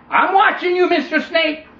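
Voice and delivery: put-on high, loud wary kid; boy warning the snake he is watching it, high and loud. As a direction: warn him like a cautious child.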